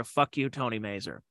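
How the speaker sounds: quiet. Retold or imitated. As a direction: say it softly, missing him. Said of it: "I'm not gonna hear".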